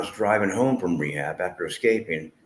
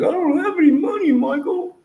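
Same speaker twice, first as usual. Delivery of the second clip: very high, louder put-on girlfriend voice; imitating Cindy; 6 dB louder than usual. Girlfriend on the phone refusing bail money, high squeaky imitation. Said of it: "She's like".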